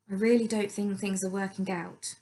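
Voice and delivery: usual speaking voice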